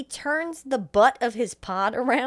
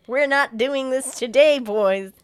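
louder, sassy diva attitude; sassy drag queen; 4 semitones higher, 7 dB louder, slower than usual. Retold or imitated. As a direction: serve it like a fierce drag queen.

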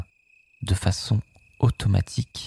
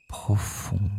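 soft echo; 5 dB quieter than usual. Shown as a demonstration. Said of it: "echo of profond".

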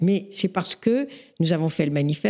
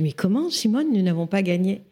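put-on incredulous young enthusiast; 2 semitones higher than usual. Twenty-four-year-old activist questions Simone's verdict, incredulous. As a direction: protest in disbelief, imitating your eager younger self. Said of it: "pleine d'enthousiasme".

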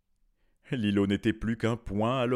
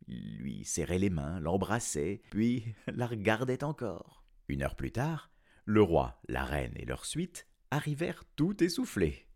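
ordinary delivery

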